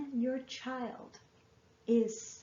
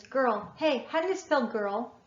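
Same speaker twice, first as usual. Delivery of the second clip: put-on loud eager child's question, rising at the end; child calling out; 3 semitones higher, 8 dB louder than usual. Child calling to parent for a spelling, loud and eager. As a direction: call across the room like a kid wanting help. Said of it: "if your child says".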